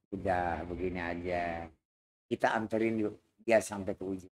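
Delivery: low, calm suggestion; subdued boss. Acted. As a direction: settle the gang down quietly. (bos begal bicara rendah dan tenang)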